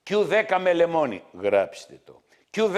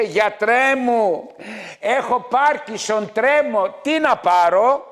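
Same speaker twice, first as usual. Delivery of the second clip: mock whining patient; 6 semitones higher, 4 dB louder, slower than usual. Mocking patient pleading with doctor.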